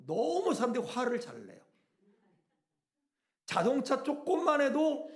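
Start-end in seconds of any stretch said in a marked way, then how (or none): none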